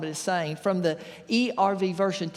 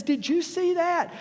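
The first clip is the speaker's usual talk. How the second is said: amazed farmer; 10 semitones higher, slower than usual. Retold or imitated. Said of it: "Farmer looks to his friend and says".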